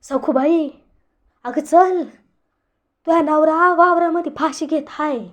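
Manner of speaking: alarmed shouting. A narrator's reading. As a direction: yell the news to Sakhubai in panic. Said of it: "फकीरा जोराजोरानं ओरडायला लागला".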